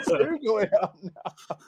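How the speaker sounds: high laughing words; giggling through the line; real laughter carrying the words. Real, not acted. Laughing while talking.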